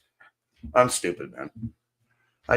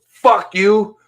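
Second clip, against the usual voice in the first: much higher, much louder yelled curses; furious shout; 9 semitones higher, 8 dB louder, slower than usual. Pretend. Imagined raging at the Canadian Tire store, yelling. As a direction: scream it at the store staff. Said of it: "and say".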